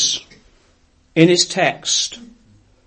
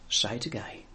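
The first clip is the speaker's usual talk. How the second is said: much softer, almost breathed; a quiet prompting voice; hushed inner voice; 5 semitones lower, 12 dB quieter than usual. Retold or imitated. Inner voice urging the preacher, hushed. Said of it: "The little voice said".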